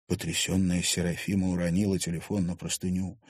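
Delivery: usual speaking voice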